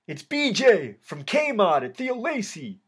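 high, slow, drawn-out call; yelled out. A narrator's reading. Inmate identifying himself to K nine across the day room, yelled out. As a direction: call out slowly so it carries across. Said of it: "I yelled out".

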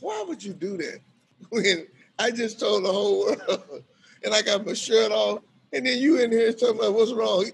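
laughing complaint. Retold or imitated. Eric scolding the narrator, laughing. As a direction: complain while cracking up. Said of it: "He said".

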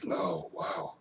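soft whispered wow. Real, not acted. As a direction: breathe out the words in pain. Whispered gasp from pepper burn.

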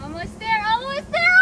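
almost there, building yell; excited cheering. Real, not acted. Cheering partner drinking hot sauce.

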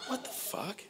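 high, hushed disbelief; stunned disbelief. Retold or imitated. Customer told Tim's gone home for the day, quietly stunned. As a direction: squeak it out softly, astonished.

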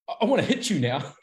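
lightly acted tantrum; little kid. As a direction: imitate a petulant little kid losing an argument. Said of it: "It was like a little kid sort of thing".

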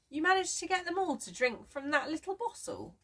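high-pitched, amazed question; can't believe it; surprised question. A narrator's reading. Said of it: "asked Francis in surprise".

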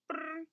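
high trilled buzz; imitated doorbell. A narrator's reading. Narrator imitating the doorbell ringing, high trill. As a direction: roll it high like a ringing bell. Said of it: "the door went".

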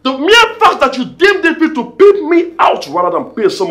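high, loud indignation; angry accusation. Acted. Husband accusing wife of pimping him out, loud indignation. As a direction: spell out the grievance at full voice.

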